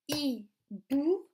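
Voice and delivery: "Hibou" split as hi, pause, bou; syllable by syllable. Shown as a demonstration. Teacher shows children the second syllable, "Hibou" split syllable by syllable. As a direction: separate "Hibou" into two, second syllable rising. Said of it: "dans la deuxième syllabe".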